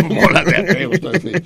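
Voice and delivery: riendo a carcajadas, agudo; laughing. Real, not acted. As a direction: suelta la risa mientras habla. Carcajada del conductor. (carcajada abierta entre palabras)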